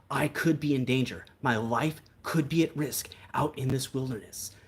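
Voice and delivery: solemn awe for the bears, dramatic; hammed-up nature documentary earnestness; Timothy Treadwell impression. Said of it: "That was my Timothy Treadwell impression".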